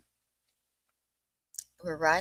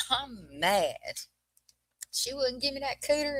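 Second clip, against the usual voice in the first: imitated mad little Southern boy, pitched up; tickled replay of a Southern kid's complaint; mock-indignant Southern child voice; 6 semitones higher than usual. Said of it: "just real Southern kid style".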